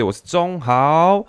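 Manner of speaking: high, very slow showman announcement; dramatic name reveal. Acted. Vlogger revealing his name, grand and very slow. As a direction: unveil your own name like a grand host. Dramatic self-introduction on the show.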